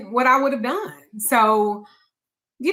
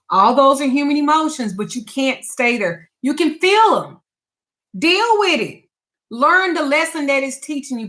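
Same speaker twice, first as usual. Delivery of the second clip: higher and louder, urging; preaching; 4 semitones higher, 4 dB louder than usual. Real, not acted. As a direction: build up like a sermon. Preaching advice to viewers.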